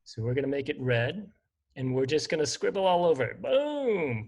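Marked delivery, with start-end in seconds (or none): 3.4-4.3 high, sudden explosion boom; cannon boom. Pretend. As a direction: blurt out a boom like a blast. Scribbling explosion on sprite.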